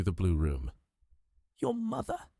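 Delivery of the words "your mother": high, soft gasping question; stunned, barely believing; shocked disbelief. A narrator's reading. Shocked lady hears the revelation.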